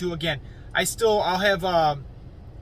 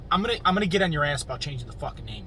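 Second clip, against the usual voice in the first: ordinary talk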